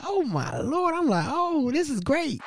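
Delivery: high giddy squeaky voice; excited. Retold or imitated. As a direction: gush like a thrilled fan. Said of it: "I was excited".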